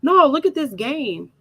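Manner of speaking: high, eager retelling; imitated excited husband. Husband urging wife to see the game, mock excitement.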